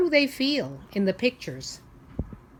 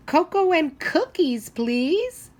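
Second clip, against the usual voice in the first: high, bright sweet request; polite little boy; 9 semitones higher, 5 dB louder, slower than usual. Acted. Little boy ordering from the waitress, high and sweet. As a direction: ask sweetly like a happy kid.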